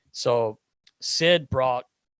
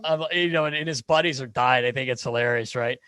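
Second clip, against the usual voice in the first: ordinary delivery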